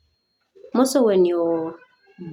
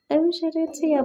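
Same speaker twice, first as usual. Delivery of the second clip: pained wish; 8 semitones higher than usual. Retold or imitated. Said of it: "I'm like".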